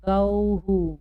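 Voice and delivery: गौहु with the visarga said as हु; गौहु as gau-hu; model reading. Shown as a demonstration. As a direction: end गौहु on a short हु. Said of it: "हमें हु उच्चारण करना होगा".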